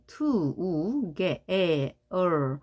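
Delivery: "together" pulled apart into separate drawn-out sounds; to, ge, ther, each stretched separately; syllable by syllable. Shown as a demonstration. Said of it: "각각 있죠?".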